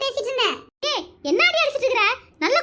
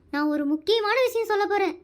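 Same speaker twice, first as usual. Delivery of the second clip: ordinary talk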